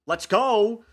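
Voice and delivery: loud high victory shout; excited cheer. Real, not acted. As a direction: cheer as if you just won. Player cheers natural twenty.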